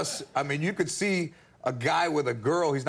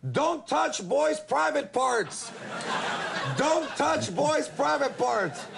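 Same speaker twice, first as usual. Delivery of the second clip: high, slow protest chant; drawn-out, high-pitched mock lady's yell; imitated woman yelling; 8 semitones higher, much slower than usual. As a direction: yell it word by word like a heckler. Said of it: "this one lady who's yelling".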